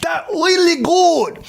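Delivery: very high, slow, laughing mock Japanese accent; hammed-up Japanese accent praising food. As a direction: gush over the rib in a squeaky mock Japanese accent. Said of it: "And then he goes".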